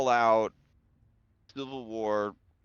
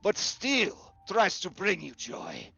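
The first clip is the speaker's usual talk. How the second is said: higher, cold accusing lord; menacing Baron; 7 semitones higher, 4 dB louder than usual. Acted. Baron accusing the nervous man of mockery, menacing. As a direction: twist the laugh into a confession.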